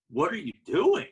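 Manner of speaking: loud, high startled question; bewildered friends. Retold or imitated. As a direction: blurt it out, stunned. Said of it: "they're like".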